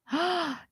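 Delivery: sharp surprised gasp. Real, not acted. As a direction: gasp in shock. Shocked at search result.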